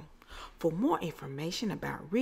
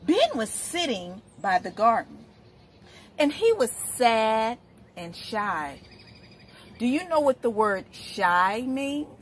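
put-on reading to children; 3 semitones higher than usual. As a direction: read it like story time on the rug. Reads picture book to children.

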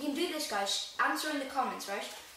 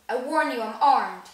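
much louder, threatening shout; loud threat; 2 semitones higher, 13 dB louder than usual. Acted. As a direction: bellow the warning. Vernon threatening intruder.

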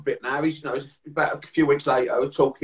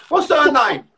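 louder, higher mock exasperation; getting the hump; 9 semitones higher, 7 dB louder, slower than usual. Dad can't name the girlfriend Janet, getting the hump. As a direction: demand the name in rising annoyance. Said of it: "he was getting the right ump".

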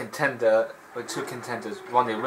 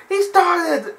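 put-on high, loud childish protest; mock indignant high yelp; Wolf protesting; 6 dB louder than usual. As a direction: whine it like a caught gladiator. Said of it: "Wolf was like".

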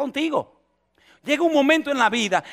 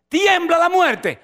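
preaching climax; 7 semitones higher, 4 dB louder, much slower than usual. Acted.

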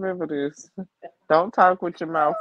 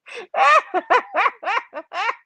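squealing laugh pitched way up; high shrieking laugh; 5 dB louder than usual. Real, not acted. Teasing Derek in the chat, high squeal.

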